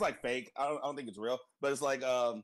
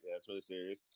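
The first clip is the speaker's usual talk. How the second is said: monotone voice; 10 semitones lower, 11 dB quieter than usual. Retold or imitated. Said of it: "monotone voice".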